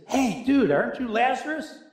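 high excited dude; excited passerby. Retold or imitated. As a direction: play the gushing stranger. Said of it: "And he says".

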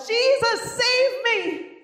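high, loud cry of save me; desperate shout to Jesus; calling for help. Retold or imitated. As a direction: cry out while sinking. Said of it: "he called out".

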